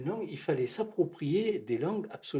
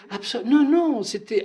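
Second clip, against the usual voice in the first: much higher, louder burst of no; startled denial; 12 semitones higher, 12 dB louder than usual. Real, not acted. The speaker cuts off the question with a loud, high surprised no. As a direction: jump in to correct it at once.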